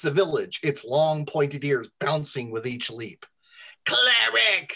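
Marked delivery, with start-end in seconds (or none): 3.9-4.7 high, loud goblin shriek. A narrator's reading. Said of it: "Spittle shrieked".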